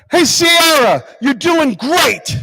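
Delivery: high, loud call stretched out slowly; coach yelling encouragement. Retold or imitated. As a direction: shout it across the field to cheer her on. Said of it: "her coach yells out".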